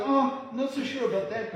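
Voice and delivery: high-pitched, hesitant objection; doubtful feedback. Acted. Acted doubtful listener.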